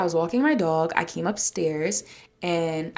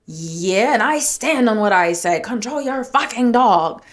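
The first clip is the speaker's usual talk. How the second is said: raised voice, slowing hard on the insult; defiant; 4 semitones higher, 5 dB louder, slower than usual. Retold or imitated. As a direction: imitate a man loudly standing his ground. Man defiantly repeats his insult.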